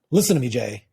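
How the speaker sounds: firm, no-nonsense interruption; strong tone of voice. Retold or imitated. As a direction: cut in firmly, taking charge. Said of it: "said it with a strong tone of voice".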